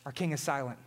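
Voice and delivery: dropped low and soft, almost a breath; hushed refrain. Real, not acted. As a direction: let the words fall away into silence. Dramatic refrain about silent King.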